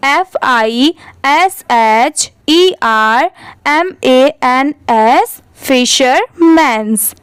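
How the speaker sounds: letter by letter, slow and clear; slow teacher spelling drill. Shown as a demonstration. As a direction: spell it out slowly for students to repeat. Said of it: "आप भी मेरे बाद repeat करिए".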